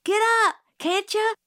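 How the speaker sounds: high and loud, desperate; screaming. A narrator's reading. Jim screams at Scout to get up. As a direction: yell her to her feet. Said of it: "Jim was screaming".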